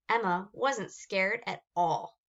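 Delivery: ordinary delivery, nothing marked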